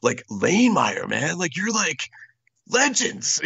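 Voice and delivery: high-pitched fan insistence, peaking on the last word; starstruck gushing. Retold or imitated. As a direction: gush like an awed fan correcting his idols. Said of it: "I was so excited".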